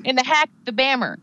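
In the hack, the bammer with the letters of back and hammer swapped; spoonerism. Pretend. Playing at Jamie's slips, the letters swapped in hack and bammer. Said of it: "you switch your actual letters".

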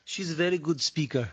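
put-on broken English in a thick Russian accent; hammed-up Russian accent; mock Russian accent. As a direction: play it with a Slavic accent. Joins in mock Eastern European accent.

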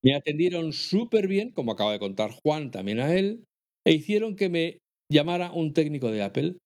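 reading a letter aloud. Acted. Reads listener's email.

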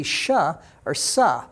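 sh as "shuh", then sa as "suh"; contrasted letter sounds. Shown as a demonstration. Teacher contrasting two readings of one letter, contrasted sounds. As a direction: set hushed sh against plain s in "sh or sa". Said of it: "the same letter can either be".

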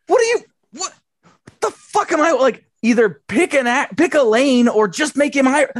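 exasperated rant. Retold or imitated. Exasperated reaction in the bit.

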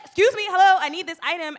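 high and loud, pleading for help; damsel in distress act. Shown as a demonstration. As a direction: call out like a helpless lady. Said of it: "I'm like".

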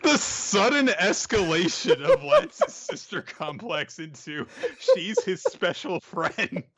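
high, drawn-out words through real laughter; laughing. Real, not acted. Co-host on Lance's sister complex, laughing hard. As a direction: talk while helplessly cracking up.

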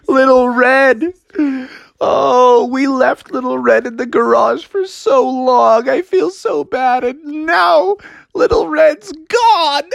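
mock-tragic high lament, slowed; hammed-up high wailing. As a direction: overdo the grief like a cartoon. Bears lament lost wagon.

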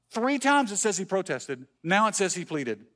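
ordinary delivery

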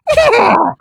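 high loud horse whinny; whinny like a horse, shrill and loud. Retold or imitated. Telling a friend about the dead son's horse, high loud horse whinny. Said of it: "how to do a horse impression".

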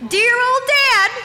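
high, loud, drawn-out announcement; mock horror. Revealing dad at the kitchen sink, high mock horror. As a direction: stretch each word in high mock dismay.

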